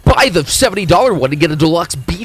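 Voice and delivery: loud hammed-up ad read; mock ad pitch. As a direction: sell it like a cheesy ad.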